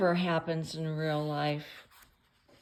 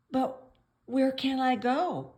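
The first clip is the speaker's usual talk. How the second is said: high, louder storybook protest; barked plea; 7 semitones higher, 5 dB louder than usual. A narrator's reading. Man thrown out of the doghouse, barking his question. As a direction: bark it out as a helpless question. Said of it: "barked the man".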